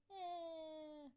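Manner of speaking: long, high, loud bawl; hammed-up crying wail. A narrator's reading. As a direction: bawl on one drawn-out high note. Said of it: "she started wailing even louder".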